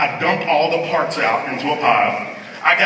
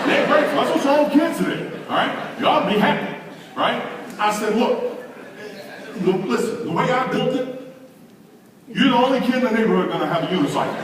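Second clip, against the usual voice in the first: a hammed-up dad scolding kids these days; comic rant; 9 semitones higher than usual. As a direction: build the mock lecture higher and higher. Father's rising comic rant.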